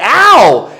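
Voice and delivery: loud high imitated ow; mock yelp. Girl struck by cane, loud high mock yelp. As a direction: yelp like you just got hit. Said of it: "she's like".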